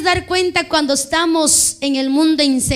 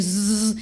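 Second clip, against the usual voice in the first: soft buzzing shake noise; low, quiet "zzz" sound effect; imitated buzzing; 5 semitones lower, 6 dB quieter than usual. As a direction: buzz softly to mimic trembling bodies. Imitates people shaking in church.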